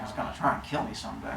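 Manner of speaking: low, fast, soft grumble; grim mutter. Retold or imitated. Cronus answering his wife about the baby, low quick mutter. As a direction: mutter it darkly under your breath. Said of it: "he's like".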